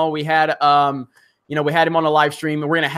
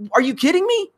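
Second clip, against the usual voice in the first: much higher, disbelieving cry; can't believe what they just asked; incredulous outburst; 13 semitones higher than usual. Real, not acted. Disbelief at Team YouTube to viewers, voice jumping high.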